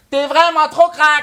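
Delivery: mock Québécois accent on the callback line. French rapper quoting the rival's mother, mocking her Québécois accent. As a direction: throw back the Montreal girl's line in a Québécois accent. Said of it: "C'est quoi cet accent de merde".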